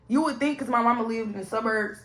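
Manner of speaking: ordinary talk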